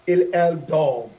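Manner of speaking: L L dort drawn out slowly; slow down and land on dort; slow drill. Shown as a demonstration. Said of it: "You add S".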